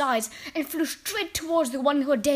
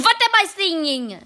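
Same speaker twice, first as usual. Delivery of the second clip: higher, much louder repeated demand; witch's insistent question; 7 semitones higher, 9 dB louder than usual. A narrator's reading. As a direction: demand an answer as an angry witch. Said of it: "I am asking you".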